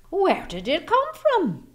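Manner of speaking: high, loud astonished question; amazed villagers. A narrator's reading. Villagers amazed at the giant potato, high and loud. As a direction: gasp it out in wonder. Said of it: "they asked".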